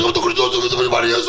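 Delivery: low, growled mock-Greek rant; wrestler-like husband. Husband raging in the kitchen, low wrestler voice. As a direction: drop deep into a wrestler's voice. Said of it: "He sounds like a sawmine wrestler".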